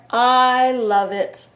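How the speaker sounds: bear relish. Acted. Bear enjoys blueberry pie.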